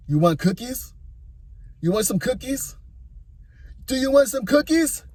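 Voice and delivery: coaxing, pitch rising on every ask; coaxing wife. Retold or imitated. Wife calling him over, coaxing and rising.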